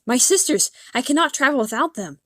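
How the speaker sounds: put-on girl shouting to the man for her sisters; shouted. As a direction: call out in panic for the sisters. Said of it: "I snapped out of my daze and shouted".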